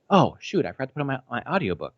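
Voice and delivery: lightly acted remembering something forgotten; retold sudden realization.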